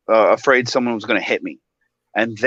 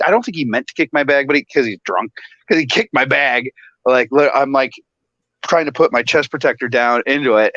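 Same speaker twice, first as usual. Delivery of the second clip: ordinary talk, nothing marked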